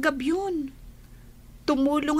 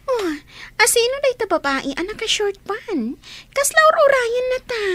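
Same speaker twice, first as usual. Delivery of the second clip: a hurt, loud half-sob demanding who the woman is; tearful jealous outburst; 8 semitones higher, 4 dB louder than usual. Acted. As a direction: voice rising into crying as she accuses him. Jealous woman confronting man. (nasaibo a pagsaludsod a naapal)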